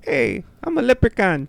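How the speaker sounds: squeaky high Irish leprechaun voice; leprechaun Irish accent. Pretend. Co-host on request, high cartoon Irish leprechaun accent. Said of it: "Give me a leprechaun Irish, Irish accent".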